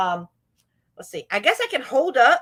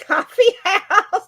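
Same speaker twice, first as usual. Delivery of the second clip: laughing; 10 semitones higher, 7 dB louder, slower than usual. Real, not acted. Laughs over the name.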